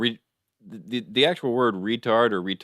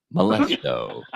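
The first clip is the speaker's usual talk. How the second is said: announcer introducing clown; 13 semitones higher, slower than usual. Acted. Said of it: "And now to perform".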